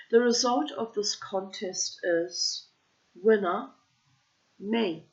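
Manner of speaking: hint of a cocky bird; smug parrot. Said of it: "Then he said".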